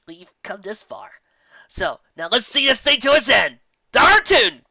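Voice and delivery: slow start, then a loud rallying cry; rally the troops; cried out. Acted. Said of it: "cried Applejack".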